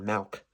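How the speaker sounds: "milk" pronounced "malk", with an A vowel. Shown as a demonstration. Disputing how some people say it, "milk" mispronounced with an A. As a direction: swap the I in "milk" for an A. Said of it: "there's no A in milk".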